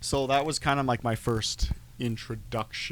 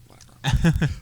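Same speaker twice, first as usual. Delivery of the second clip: loud dismissive whatever; 5 semitones higher, 14 dB louder, slower than usual. Retold or imitated. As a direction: brush it off loudly. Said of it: "I'm like".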